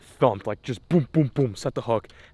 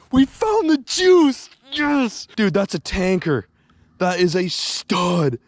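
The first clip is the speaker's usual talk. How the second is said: excited drawn-out cheer; 9 semitones higher, 5 dB louder, much slower than usual. Real, not acted. Big fish landed, drawn-out cries.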